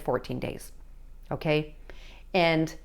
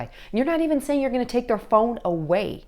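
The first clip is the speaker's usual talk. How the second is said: normal talking voice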